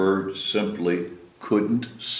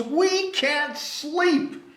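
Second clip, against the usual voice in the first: high, slow whining complaint; tired animals grumbling; whiny animals; much slower than usual. Acted. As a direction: drag out the protest.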